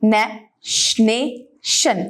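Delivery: नेशनेशन broken into slow, separated syllables. Shown as a demonstration. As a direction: sound out नेशनेशन piece by piece, pausing between syllables. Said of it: "इस word को आपने पढ़ना कैसे है".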